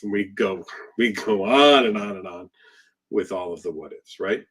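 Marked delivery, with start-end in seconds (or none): none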